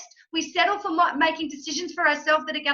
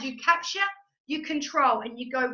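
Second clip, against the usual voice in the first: ordinary talk, nothing marked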